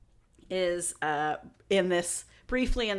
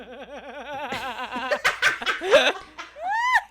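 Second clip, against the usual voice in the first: long, higher-pitched burst of laughter; laughing; 5 semitones higher, 4 dB louder than usual. Real, not acted. Podcast host cracking up at the story, long laugh. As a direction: crack up helplessly.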